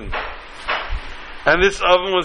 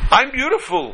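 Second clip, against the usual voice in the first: amazed; 9 semitones higher than usual. A narrator's reading. Teacup sees itself amazed.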